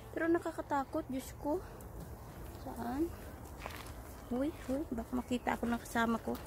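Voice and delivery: hushed and scared, sneaking around; whispering while hiding. Real, not acted. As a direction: keep it down so nobody hears. Baka makita ako ng kasama ko.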